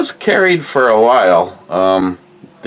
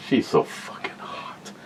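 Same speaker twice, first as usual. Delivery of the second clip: unvoiced whisper under the breath; whispered aside; 2 semitones lower, 13 dB quieter than usual. Real, not acted.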